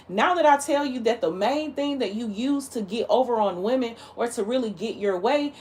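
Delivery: usual speaking voice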